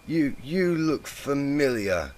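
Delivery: put-on slow, high slurring drunk voice; drunken slur. A narrator's reading. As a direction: slur it like a tipsy mare. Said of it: "she slurred".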